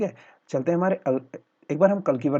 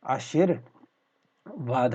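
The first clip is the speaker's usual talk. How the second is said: आशीर्वाद split slowly, "ashir... vad"; broken in parts; much slower than usual. Shown as a demonstration. Teacher showing a common spelling slip, slowly split. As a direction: pause after ashir in आशीर्वाद. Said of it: "आशीर्वाद में हम क्या करते हैं".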